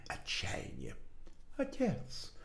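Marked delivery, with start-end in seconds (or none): 1.6-2.3 put-on higher, softer, muffled young voice; muffled young man's voice. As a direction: call out like a distant son. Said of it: "Голос был приглушённый, высокий".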